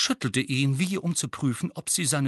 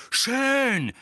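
high loud yell; angry teenager yells at his uncle; shouting; 13 semitones higher, 6 dB louder than usual. A narrator's reading. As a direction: snap back in a rage. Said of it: "schrie Harry".

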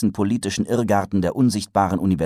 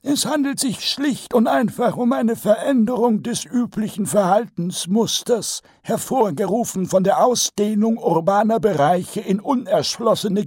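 put-on pompous old wizard; 4 dB louder than usual. A narrator's reading. Archchancellor lectures Vetinari on dragons, high and pompous. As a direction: hold forth like a self-pleased old professor.

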